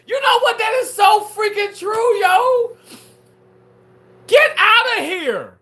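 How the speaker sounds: high, loud hammed-up sob; cry-shout in exaggerated agreement; fake crying.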